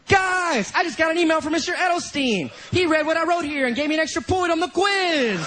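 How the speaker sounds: high-pitched, gushing fake news; hammed-up excitement; excited announcement. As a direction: announce it to classmates in mock glee. Acted gleeful fake announcement online.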